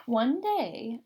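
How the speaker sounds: plain reading voice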